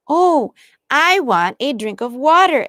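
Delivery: sudden exclaim. A narrator's reading. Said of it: "exclaimed Flossie suddenly".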